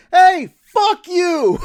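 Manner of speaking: high, drawn-out mock heckle; gleefully hammed-up, slow high-pitched yell; imitated vitriol. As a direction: imitate an angry off-screen heckler. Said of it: "listen to the vitriol".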